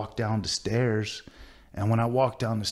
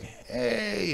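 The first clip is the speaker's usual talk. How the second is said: put-on high, drawn-out drunk greeting; drunken Paul; 13 semitones higher than usual. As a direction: imitate a slurring old drunkard calling hello. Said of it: "sitting there all drunk".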